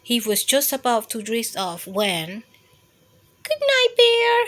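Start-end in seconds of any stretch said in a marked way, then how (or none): 3.4-4.4 high squeaky good night; high mouse voice. A narrator's reading. Mouse saying good night to Bear, high squeaky voice. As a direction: chirp it up high and bright. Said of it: "said Mouse softly".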